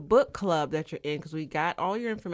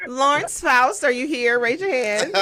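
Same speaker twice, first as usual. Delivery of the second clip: mock roll call; 6 semitones higher, 6 dB louder than usual. Playful roll call like a teacher.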